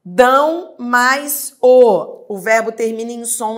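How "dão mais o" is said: "Dão mais o" very slow and louder; slow demo. Shown as a demonstration. Teacher reads the next exercise item, very slow. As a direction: draw out "Dão mais o" syllable by syllable. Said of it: "Próximo".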